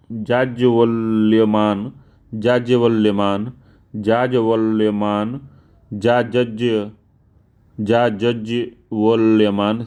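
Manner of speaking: जाज्वल्यमान drawn out syllable by syllable, slowly; slow spelling out. Shown as a demonstration. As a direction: sound out जाज्वल्यमान slowly with a rising end. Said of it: "तो कौन सा शुद्ध है". (जाज्वल्यमान को धीरे-धीरे अक्षर-अक्षर बोलना)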